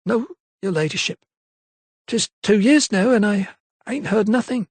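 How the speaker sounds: a lightly voiced maid with a touch of sorrow; sad maid. A narrator's reading. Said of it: "shook her head sadly".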